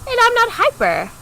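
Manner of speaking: high-pitched defensive denial; defensive, high "not hyper"; indignant retort. Retold or imitated. Retorting to the therapist.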